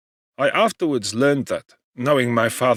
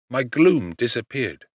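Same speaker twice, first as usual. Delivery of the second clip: plain reading voice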